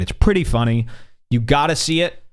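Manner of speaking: usual speaking voice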